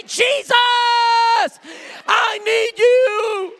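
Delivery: high, slow, loud cry. Acted. Worshipper willing to look foolish for Jesus, shouting. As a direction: shout it out, stretching the words. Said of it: "willing to raise a voice".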